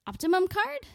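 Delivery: put-on high-pitched clerk voice; checkout clerk. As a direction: pipe up like a cashier asking by rote. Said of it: "and she says".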